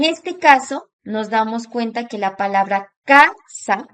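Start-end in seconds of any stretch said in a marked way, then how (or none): 3.0-3.9 casa with a strong first syllable; stress on CA. Shown as a demonstration. Teacher pointing out the stronger syllable, stress on CA in casa. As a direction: hit the CA of casa, then release. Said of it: "tiene un acento mucho más fuerte en K".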